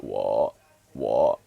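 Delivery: creaky dipping tone; croaky low tone. Shown as a demonstration. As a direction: croak "Wo" deep in the throat. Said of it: "have that croaky voice".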